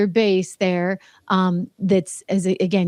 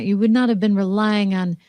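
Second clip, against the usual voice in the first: ordinary delivery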